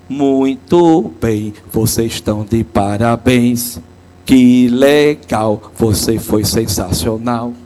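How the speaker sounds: low, slow, drawn-out words of praise. Retold or imitated. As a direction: savour each compliment, easing down. Priest praising a child's answer.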